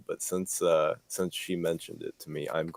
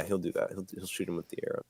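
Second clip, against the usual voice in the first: ordinary talk, nothing marked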